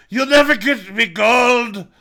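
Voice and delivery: high, loud, stretched-out leprechaun parody; Sheamus impression. Mocking Sheamus as a leprechaun, hammed-up high shout. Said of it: "And Sheamus like".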